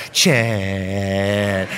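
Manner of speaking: low, drawn-out buzz of a mosquito saying a name. Retold or imitated. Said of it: "They would buzz my name all day long".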